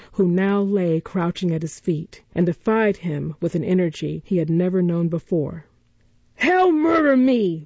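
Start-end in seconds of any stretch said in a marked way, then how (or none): 6.4-7.6 high, loud blubbering panic. A narrator's reading. Noah blubbers in terror after Oliver knocks him down. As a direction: wail it like a coward in terror. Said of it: "blubbered Noah".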